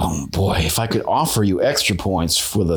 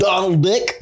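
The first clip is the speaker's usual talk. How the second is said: higher Donald Duck voice; 9 semitones higher than usual. Acted. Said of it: "All right, [name]".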